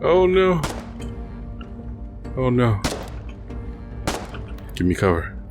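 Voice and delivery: normal talking voice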